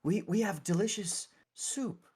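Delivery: high-pitched, halting cleric; eager, hesitant clergy member. Acted. Clergy member offers food and healing.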